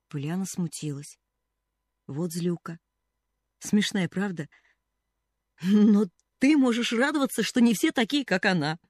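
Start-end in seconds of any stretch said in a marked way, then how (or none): 5.6-8.8 higher, louder eager comfort; little girl eagerly cheering up the cashier. A narrator's reading. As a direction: cheer her friend up brightly.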